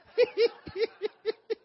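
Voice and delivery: loud, high cackling laugh. Real, not acted. Preacher cracks up mid-sermon.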